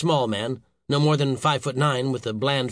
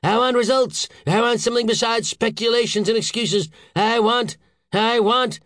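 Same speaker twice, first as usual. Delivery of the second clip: very high, loud outburst; frantic, exasperated boss; dramatic demanding rant; 10 semitones higher, 4 dB louder than usual. A narrator's reading. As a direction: demand it theatrically. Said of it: "waving his arms dramatically".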